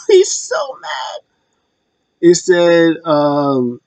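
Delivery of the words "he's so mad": very high, drawn-out squeal with laughter; tickled, squeaky emphasis; gleeful amusement. Real, not acted. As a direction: laugh through it in a slow falsetto. Gleeful at wrestler's extreme anger.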